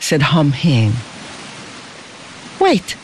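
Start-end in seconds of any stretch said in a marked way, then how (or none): 2.6-3.0 high, sudden command. A narrator's reading. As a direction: stop them with an official order, pitched high. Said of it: "commanded one of the officers".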